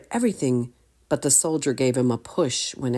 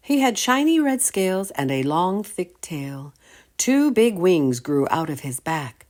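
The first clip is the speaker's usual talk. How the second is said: ordinary delivery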